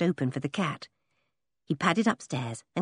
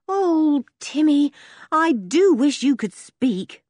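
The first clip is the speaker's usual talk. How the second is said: put-on higher, slower anxious girl; girl Henry, anxious; 8 semitones higher, 5 dB louder than usual. Girl begging the dog to explain the note, higher anxious voice. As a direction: plead with the dog for answers.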